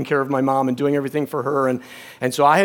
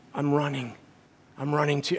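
weak, hushed voice, much lower and softer; dying dad's effort; 3 semitones lower, 9 dB quieter than usual. Retold or imitated. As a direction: imitate a frail dying man singing out softly. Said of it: "he just starts doing this".

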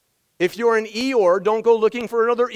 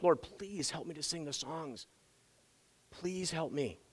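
quiet prayer; 4 semitones lower, 15 dB quieter than usual. Retold or imitated. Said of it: "I'm like".

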